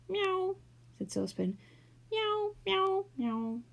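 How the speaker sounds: high mock meow; cat meow. A narrator's reading. Saucepan mewing at the clockwork mouse. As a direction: mew like a cat to scare it.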